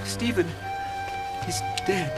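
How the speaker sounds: hushed, halting news of death; grieved announcement. Acted. Timon telling apostles of a death, hushed grief. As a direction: break it softly, with a heavy pause.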